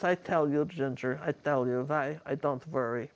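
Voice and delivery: imitated Bulgarian accent with V for W; Eva's Bulgarian accent. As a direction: explain it in a borrowed Bulgarian accent. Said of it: "I loved her accent".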